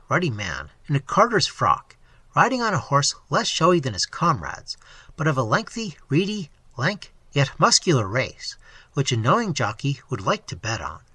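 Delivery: ordinary delivery, nothing marked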